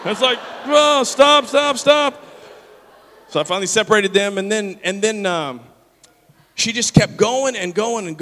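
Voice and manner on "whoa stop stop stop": high, loud shout to break up a fight; retold shouted intervention; acted alarmed shout. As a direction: call a halt urgently, as reenactment.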